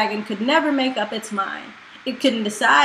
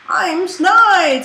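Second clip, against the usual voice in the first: sharp-tongued dragon head; 6 semitones higher, 6 dB louder than usual. Acted. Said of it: "said the head with the sharpest tongue".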